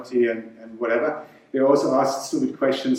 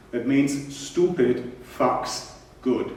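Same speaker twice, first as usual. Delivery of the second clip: ordinary delivery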